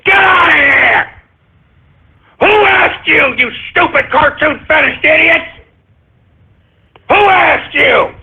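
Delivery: high-pitched outburst of indignation; angry yelling. Real, not acted. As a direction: tear into them, shouting them away. Outburst at listeners requesting things.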